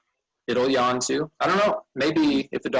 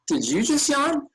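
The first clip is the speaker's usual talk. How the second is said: higher, playful accusation; surprised; 12 semitones higher than usual. Real, not acted. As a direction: catch them in the act. Catches child yawning.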